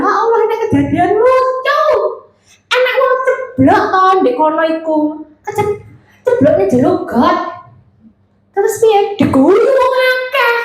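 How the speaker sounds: high-pitched, animated gossip storytelling; lively intonation. Shown as a demonstration. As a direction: tell it like juicy gossip, voice swooping up high. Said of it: "kalau ngomong tuh enak nadane". (bercerita heboh dengan nada tinggi naik-turun)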